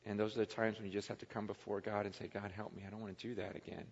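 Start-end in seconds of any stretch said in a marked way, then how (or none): 2.3-3.8 hushed prayer. Retold or imitated. Quoted humble prayer to God.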